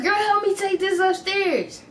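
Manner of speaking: lightly voiced stepmom asking for help. Voicing the stepmom.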